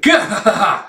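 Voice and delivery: high, theatrical "gah-ha-ha"; mock gloating laugh. Fighter gloating over shattered enemies, hammed-up laugh. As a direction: imitate a boastful fighter's cackle. Acted boastful villain laugh.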